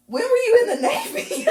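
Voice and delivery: high, loud, laughing through the quote; can barely get the words out for laughing; real laughter breaking into a retold question. Real, not acted. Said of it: "I'm fucking dying laughing".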